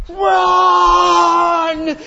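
high, piercing scream of run; desperate scream. Retold or imitated. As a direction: scream a warning while fleeing. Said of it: "She's all".

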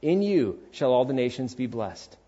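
usual speaking voice

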